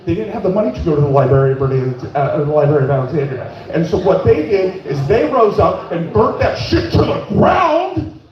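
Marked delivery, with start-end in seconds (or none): 3.7-8.2 building from plain to a high shouted rally; rising rant. Retold or imitated. Husband ranting about the library to his wife, building to a shout.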